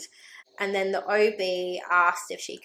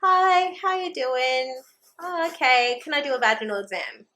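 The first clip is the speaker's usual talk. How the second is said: mock breezy doctor; 5 semitones higher than usual. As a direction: imitate her casual offhand tone mockingly. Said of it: "our conversation was like".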